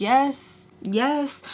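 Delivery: annoyed. Retold or imitated. Telling her stepdad on the phone, annoyed. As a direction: brush him off, fed up. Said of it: "like, annoyed with him".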